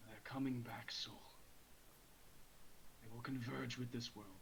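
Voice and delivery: unvoiced ominous whisper. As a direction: breathe the warning into his ear. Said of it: "I will whisper into your ear".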